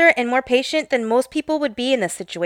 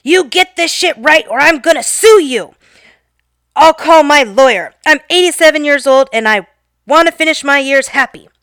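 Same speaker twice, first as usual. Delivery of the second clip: louder and higher, an imitated furious old man; mock scream; 4 semitones higher, 6 dB louder than usual. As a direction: threaten to sue her in a cranky shout. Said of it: "and he screams".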